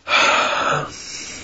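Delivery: long breathy exhale; heavy sigh. Real, not acted. Gathering himself before a tirade, long sigh.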